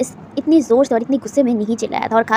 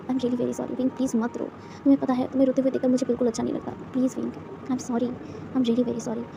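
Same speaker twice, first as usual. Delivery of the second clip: tender apology; 8 dB quieter than usual. A narrator's reading. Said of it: "किस करते हुए कहता है".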